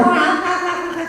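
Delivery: a shrieking imitation of frenzied church shouting; mock wild shouting. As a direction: ape a preacher howling like mad. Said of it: "y gritaban, pero a lo loco".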